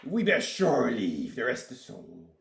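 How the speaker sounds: pirate voice. Pretend. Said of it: "in a Pirate Voice".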